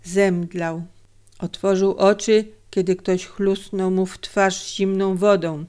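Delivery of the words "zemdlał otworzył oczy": plain reading voice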